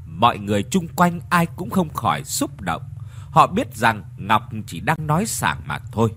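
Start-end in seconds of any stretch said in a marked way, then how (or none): none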